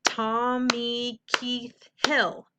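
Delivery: Tommy Keith Hill very slowly in four beats; slow, evenly spaced TOM-MEE-KEITH-HILL; syllable by syllable. Shown as a demonstration. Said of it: "then you might do".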